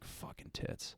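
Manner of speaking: unvoiced curse muttered very softly under the breath. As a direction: let it slip out barely audible, under your breath. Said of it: "under my breath".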